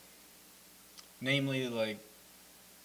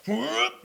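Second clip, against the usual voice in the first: whoop sound effect; 8 dB louder than usual. Retold or imitated. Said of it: "they're like".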